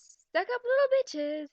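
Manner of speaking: loud, high, slow sarcastic belt; belted mock lyric. Reader belts one mocking line of the pasted lyrics.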